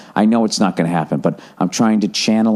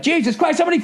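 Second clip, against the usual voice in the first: very high, strained yell, held back in volume; high-pitched exasperated rant; mock angry outburst. Caller on mute venting at the robot, angrily. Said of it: "I get the fucking anger out".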